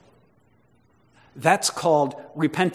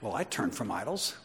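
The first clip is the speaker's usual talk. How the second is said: quiet, low boast with a rising end; understated self-righteous believer, voice dropped; 5 semitones lower, 8 dB quieter than usual. Acted. Preacher mimics a believer who takes credit for works, mildly self-righteous. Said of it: "it would be like this then".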